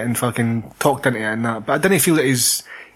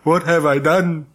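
mock Anakin; slower than usual. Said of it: "And then fucking Anakin".

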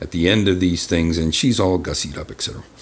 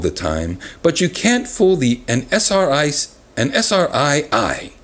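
lively, raised letter-reading voice; reading a letter aloud; 7 semitones higher than usual. Acted. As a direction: read it out like a fan letter.

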